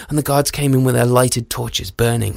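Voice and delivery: usual speaking voice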